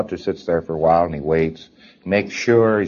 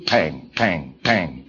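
ordinary delivery

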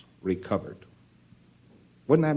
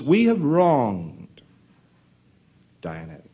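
mock contrite; 3 semitones higher, slower than usual. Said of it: "Another thing they'd say to themselves".

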